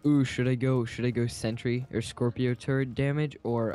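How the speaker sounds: ordinary talk, nothing marked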